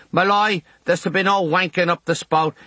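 put-on a boy hissing a scandalised warning. A narrator's reading. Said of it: "Quasimodo hisses".

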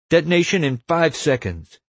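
high-pitched, self-important countdown call; countdown announcement. A narrator's reading. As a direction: announce it like an official warning. Warren calls out the countdown.

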